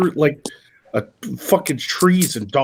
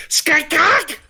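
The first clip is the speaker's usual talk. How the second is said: very high screeching owl cry; high mock raptor shriek. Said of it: "It was like".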